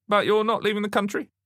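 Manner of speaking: high-pitched detective interrogating; questioning detective. A narrator's reading. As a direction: press the witness in a high voice.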